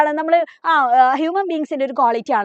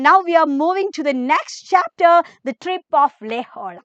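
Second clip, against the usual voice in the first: slow announcement; much slower than usual. Acted. Announces next chapter slowly.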